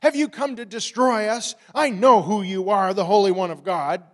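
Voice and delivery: frantic, defiant demonic outcry; demon crying out. A narrator's reading. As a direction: yell it out at the top of the voice. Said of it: "cried out at the top of his voice".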